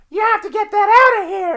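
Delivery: shrill-high, loud movie trooper impression; high-pitched voice. Imitating the trooper's original line for the co-host, high-pitched. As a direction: imitate a man with a really high-pitched voice, loudly. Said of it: "his voice is really high pitched".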